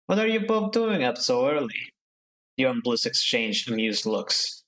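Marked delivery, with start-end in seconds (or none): 0.0-1.9 sleepy crew mate voice. Acted. Yawning Seraphim speaks.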